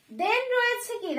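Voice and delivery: ordinary talk, nothing marked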